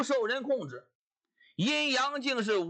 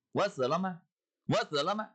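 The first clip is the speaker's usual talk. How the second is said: ordinary delivery, nothing marked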